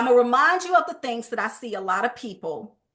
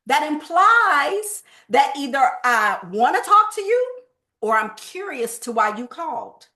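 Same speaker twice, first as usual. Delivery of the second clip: ordinary talk, nothing marked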